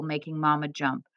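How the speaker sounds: ordinary delivery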